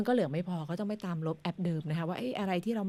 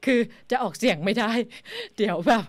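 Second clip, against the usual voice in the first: real giggles breaking into the words; higher, louder, laughing through the words; laughing explanation; 4 semitones higher, 4 dB louder than usual. Real, not acted. Host admits she can't read her ID number aloud, laughing.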